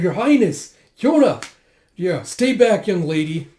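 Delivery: high, loud warning calls; calling out in alarm. Acted. Companions warn Yona to stay back, alarmed shouts. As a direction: shout to keep her out of danger.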